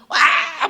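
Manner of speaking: high, loud retold cry of alarm; retold outcry. Golfers cry out as a man collapses, loud alarm. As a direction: imitate a sudden shout from behind. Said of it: "막 소리가 나요".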